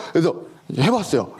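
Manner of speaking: ordinary talk, nothing marked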